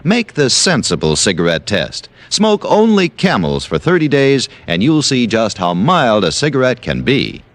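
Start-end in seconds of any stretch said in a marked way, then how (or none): none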